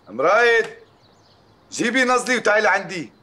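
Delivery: calling out. Acted. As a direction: summon her across the house. Father calls wife from upstairs. (نداء عالٍ من بعيد)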